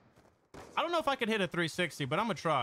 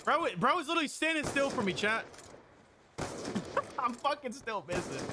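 normal talking voice

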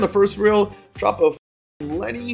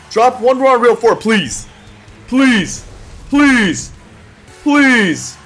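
begging; 5 semitones higher, much slower than usual. Real, not acted. Begging the slot for a hit.

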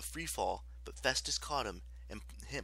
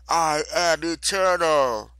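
loud, high, slow proclamation; defiant goddess; 6 semitones higher, 13 dB louder, slower than usual. A narrator's reading. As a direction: boom it out slowly as a furious goddess. Goddess boasts in battle.

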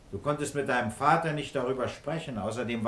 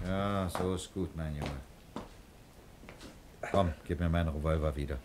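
reassuring; 9 semitones lower, 8 dB quieter than usual. Acted. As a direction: soothe the frightened boy gently. Boy finally emerges.

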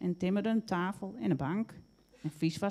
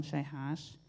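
plain reading voice

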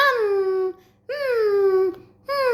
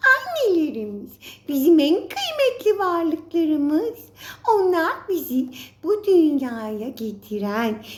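ordinary talk

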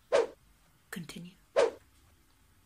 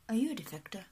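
ordinary talk, nothing marked

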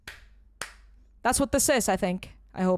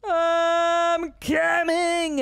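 calling out; 7 semitones higher, 4 dB louder, much slower than usual. Real, not acted. Called out loud to a friend.

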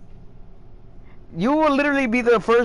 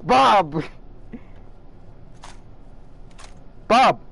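calling out; 2 semitones higher than usual. Real, not acted. Calling out to someone.

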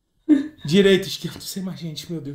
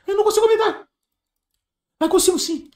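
much higher, strained panicky wail; high panicky cry; 12 semitones higher, faster than usual. Real, not acted. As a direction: squeal it out in a nervous panic. Panicking on live stream.

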